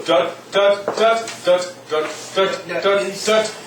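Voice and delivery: short detached duts; sung staccato syllables; staccato demo. Shown as a demonstration. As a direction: clip each dut off short. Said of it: "That's staccato".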